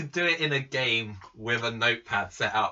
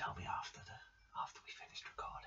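whisper; 22 dB quieter, faster than usual. Real, not acted. Secret asked for off-air.

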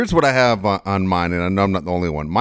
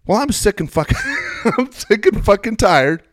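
high, loud ranting mom; mother ranting about leaving the shower, furious mock rant; 13 semitones higher, 5 dB louder than usual. Mom's rant, retold.

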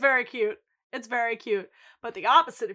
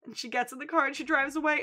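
ordinary talk, nothing marked

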